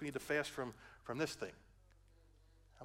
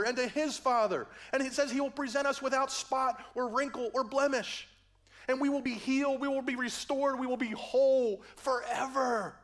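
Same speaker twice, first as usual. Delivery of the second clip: full-force preaching; 12 semitones higher, 9 dB louder than usual. Acted.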